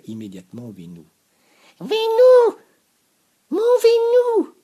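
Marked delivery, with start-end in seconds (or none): 1.8-4.5 very high, very loud swooning cry; bearded lady calling. A narrator's reading. Sophie spots Vénou across the class, swooning cry. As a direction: call out Vénou in adoration. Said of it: "dit-elle".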